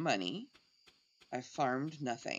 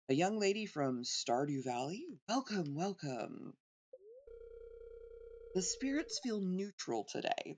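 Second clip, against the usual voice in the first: fortune teller; 5 semitones higher than usual. Pretend. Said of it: "Fortune teller".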